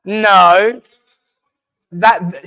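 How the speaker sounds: ordinary delivery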